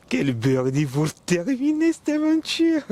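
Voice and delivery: high, slow, thrilled exclaim; excited. Real, not acted. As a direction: savour the win, high and slow. Celebrates holed birdie.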